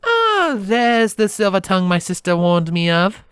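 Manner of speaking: high, teasing princess voice. Acted. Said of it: "the princess said teasingly".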